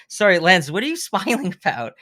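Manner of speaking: laughing nervously; asking through a nervous laugh. Retold or imitated.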